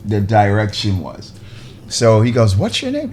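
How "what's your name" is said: incredulous questioning. Retold or imitated. Retold director questioning him.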